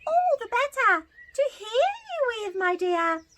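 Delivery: high put-on granny voice; wolf pretending to be sweet grandma. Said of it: "replied the wolf".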